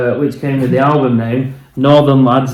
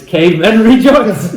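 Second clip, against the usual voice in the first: mock triumphant hail; 8 semitones higher, 5 dB louder than usual. Shown as a demonstration. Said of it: "it's like Hail Caesar".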